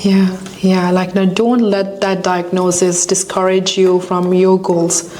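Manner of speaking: ordinary delivery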